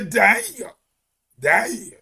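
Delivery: mock English accent, DAY said as "die"; English-accented "day", vowel opened to "eye"; Englishman's "die". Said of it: "an Englishman, the way he pronounce".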